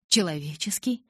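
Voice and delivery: "Человеческий" as a questioning echo, rising at the end. A narrator's reading. Edward echoes Bella's word back, "Человеческий" as a rising question. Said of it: "переспросил Эдвард".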